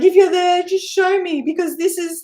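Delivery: loud, high, pleading call; loud, high cry into the night; calling out to spirit. Retold or imitated. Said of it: "and I'm like".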